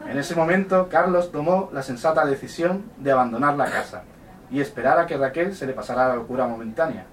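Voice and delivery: ordinary delivery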